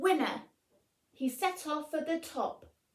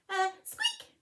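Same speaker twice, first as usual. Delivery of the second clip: higher mouse voice, small squeak; a tiny nervous noise; 8 semitones higher than usual. Acted. Mouse squeaking at the lion, high and meek.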